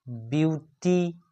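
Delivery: two separate beats on beauty; split into syllables. Shown as a demonstration. Teacher giving a two-syllable example, beauty split slowly in two. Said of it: "ये सब टू syllable वाले".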